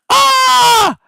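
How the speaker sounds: loud, high surprised cry; excited high yelp; happy shout. Real, not acted. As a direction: burst out in delight at a face arriving. Greets daughter-in-law joining.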